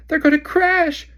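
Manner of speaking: high, loud, slow frightened shriek. Acted. Hermione shrieking as the seekers dive, frightened. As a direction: scream in alarm. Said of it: "shrieked Hermione".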